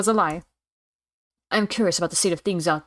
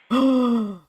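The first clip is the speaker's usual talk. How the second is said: high shocked gasp; acted gasp; 5 semitones higher, 4 dB louder than usual. Onlooker as the student goes out, shocked gasp. Character reacts to the danger.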